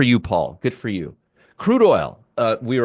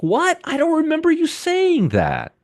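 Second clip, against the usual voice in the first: much higher, put-on incredulous viewer voice; mock whiny viewer. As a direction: squeak it out like a baffled viewer. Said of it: "somebody's gonna be like".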